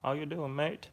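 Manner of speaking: high, rising Australian accent; caricatured Australian with upward tune; Aussie accent. Shown as a demonstration. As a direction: put on an Aussie greeting, lifting each ending. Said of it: "all you have to do for Aussie is like".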